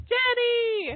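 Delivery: a lilting high hail of Jenny; high call. Real, not acted. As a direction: sing out Jenny up high to find her. Host calling the caller on line.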